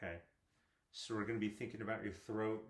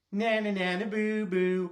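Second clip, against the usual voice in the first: high, loud playground singsong; singsong taunt; 9 semitones higher, 11 dB louder, slower than usual. Shown as a demonstration. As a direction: tease like a kid in the schoolyard. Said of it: "Can you go".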